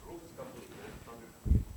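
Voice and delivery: words round a mouthful of popcorn; slow, chewing through the words; munching popcorn. Real, not acted. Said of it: "I'm still eating popcorn".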